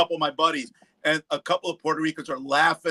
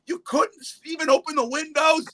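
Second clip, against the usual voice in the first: exasperated retold complaint; 12 semitones higher than usual. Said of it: "I go".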